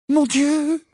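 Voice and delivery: high, frightened gasp of words; faint with fear. Acted. Hermione hearing the giant name her, faint with fear. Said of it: "semblait sur le point de s'évanouir".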